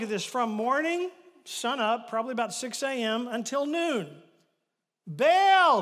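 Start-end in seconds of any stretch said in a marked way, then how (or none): 5.1-5.8 loud cry of the name, high and long; cried out. Retold or imitated. Prophets crying out to Baal, loud call. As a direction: call out Baal at full volume. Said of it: "they cried out".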